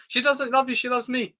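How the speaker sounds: high, whiny mock-melodrama; hammed-up high-pitched boast; overwrought drama quote. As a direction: squeal it like a bad jealous lover. Mock-quotes worst cringe line.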